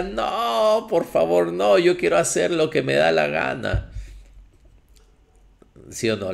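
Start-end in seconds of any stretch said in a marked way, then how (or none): 0.0-3.9 crying flesh. Acted. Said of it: "La carne grita".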